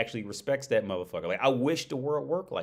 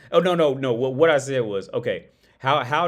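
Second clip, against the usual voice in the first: loud correction; 8 dB louder than usual. Real, not acted. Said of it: "corrects himself loudly".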